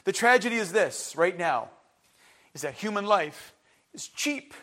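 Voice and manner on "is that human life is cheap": soft, quiet delivery of the key line; quiet statement. Real, not acted. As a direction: let it land softly, almost to yourself. Preacher repeats key phrase.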